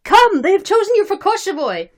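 high, loud summons; excited shout. Acted. Cossacks summoning the newly elected man, shouted. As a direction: call out urgent news. Crowd calling out the news.

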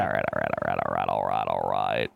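deep, low all right repeated. Acted. Drawn-out low chant.

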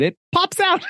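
very high, cutesy storybook voice; high-pitched read-aloud for kids; children's story reading. Retold or imitated. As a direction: sing it out like a picture book. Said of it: "like I was reading a children's story".

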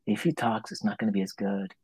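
soft, musing aside; audience's private thought. Retold or imitated. Audience member privately hoping he stays silent, hushed.

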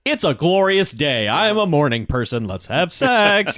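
playfully overdone wake-up enthusiasm; mock exuberance. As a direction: jump out of bed crowing, drawing out the end. Acting out the man jumping up.

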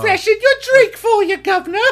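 put-on high, loud posh British accent, laughing; mock British butler. Mocking a British woman's manner with a laughing British accent. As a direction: ham up a British servant offering a drink.

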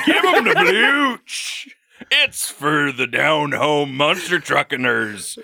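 high, slow hokey country announcer; down-home announcer. Pretend. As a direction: hype it like a county fair ad. Mock down-home ad pitch.

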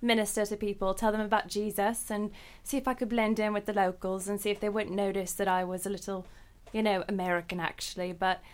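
put-on British accent, evenly paced; American trying out a British accent. Shown as a demonstration. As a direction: chat away as if a London local. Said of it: "do you do a British accent".

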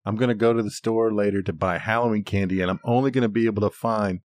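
normal talking voice

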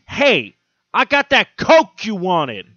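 street dealer pitch. Retold or imitated. Street dealer offers coke to a stranger, loud and slow. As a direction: play the dealer pushing his sale hard. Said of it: "Instead, he goes".

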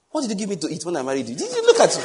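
teasing, fast, then a high laughing squeal; mock disbelief. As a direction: tease her in mock shock, breaking into laughter. Husband teasing wife about wedding.

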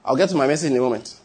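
ordinary talk, nothing marked

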